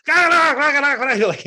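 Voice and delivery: loud, high mock German-accent yell; mock German shouting. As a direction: bark it out like a mean German officer, laughing. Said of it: "all in the German accent".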